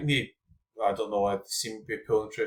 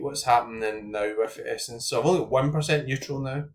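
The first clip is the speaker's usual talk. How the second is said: normal talking voice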